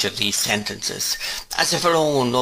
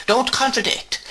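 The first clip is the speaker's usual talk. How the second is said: much higher, sharp stern rebuke. A narrator's reading. Miss Betsy forbidding the mother to contradict her. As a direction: snap it down imperiously.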